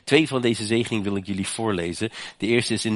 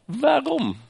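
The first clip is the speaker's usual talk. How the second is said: rhetorical question; 3 dB louder than usual. Real, not acted. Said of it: "rhetorical question repeated".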